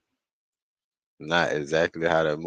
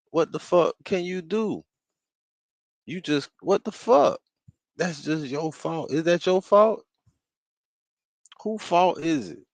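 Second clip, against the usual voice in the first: exasperated; 10 semitones higher than usual. Pretend. Ranting about no revenge on AI.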